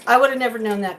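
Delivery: ordinary delivery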